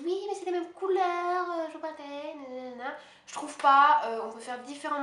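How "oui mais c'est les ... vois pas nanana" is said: high, loud whiny imitation of objectors; mock complaining classmates. Vlogger parodies her classmates' objection, mocking high voice. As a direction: mimic classmates' objection, pitched up and drawn along. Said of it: "certaines de ma classe me disent".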